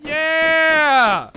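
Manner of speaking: high loud victory shout; triumphant yell. Real, not acted. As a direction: cheer the kill. Triumph after killing lion.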